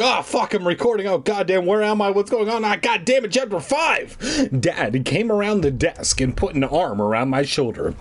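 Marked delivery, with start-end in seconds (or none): 0.0-4.2 high-pitched, hammed-up startled babble; mock surprised panic. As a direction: act caught off guard by the recording. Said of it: "surprise myself that I'm like".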